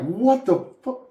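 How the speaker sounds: high, drawn-out incredulous reaction; retold disbelief. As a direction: replay your disbelief, pitched right up and slow. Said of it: "I can't believe that".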